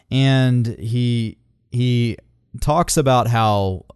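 ordinary delivery

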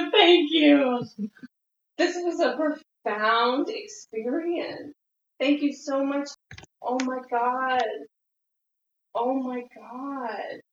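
emotional. Real, not acted. Overwhelmed gratitude after the experience.

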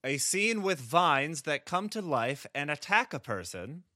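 ordinary talk

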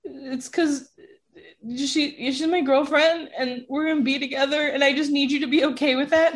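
high, halting, pleading voice; retold upset. Telling mom about the girlfriend, retold upset and emotional voice. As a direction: imitate an emotional explanation, pausing and restarting. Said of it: "I'm so upset and emotional".

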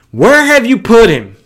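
loud, high, angry question; angry demand. Acted. Preacher voicing an angry Jesus at the tomb, loud and hot. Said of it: "I believe that that's how he asked that question".

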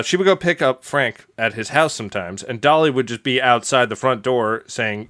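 ordinary talk, nothing marked

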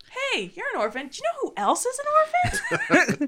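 high-pitched, rising fake-chatty voice; mock reminder. Imitating the show's clumsy orphan reminders, mocking high voice. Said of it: "No, but it's like".

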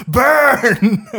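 high, loud cheer; hammed-up cheering; mock cheer. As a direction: cheer it like a crowd. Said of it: "they're just cheering".

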